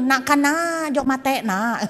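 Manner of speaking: playful mock Madurese accent. Shown as a demonstration. Preacher repeating Madurese neighbour's plea, Madurese accent. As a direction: imitate a Madurese neighbour's lilt. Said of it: "Madura kan gitu".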